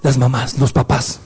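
normal talking voice

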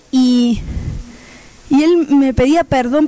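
normal talking voice

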